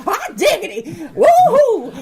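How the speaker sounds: high, loud, whooping American cowboy caricature; mock American cowboy. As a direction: ham up a yee-hawing American cowboy. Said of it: "everyone going".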